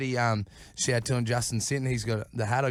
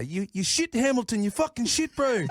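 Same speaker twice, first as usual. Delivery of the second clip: put-on pitched-up Kiwi-accent heckle from the back; 10 semitones higher than usual. Planned Kiwi-accent heckle at Hemo from the dark. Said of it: "with a Kiwi accent".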